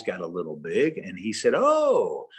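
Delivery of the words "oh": a sudden high, loud "oh"; greedy surprise. Retold or imitated. Banker seeing the certificate, sudden high loud oh. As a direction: spot the money and light up. Banker spots ten thousand dollars.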